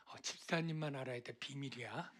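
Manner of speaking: hushed, quick, confidential aside; gossipy secret. Acted. Church gossip confiding in a deacon, hushed gossipy secret. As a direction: imitate a gossip letting slip a secret. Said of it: "마치 가십거리처럼 재밌게 즐기면서".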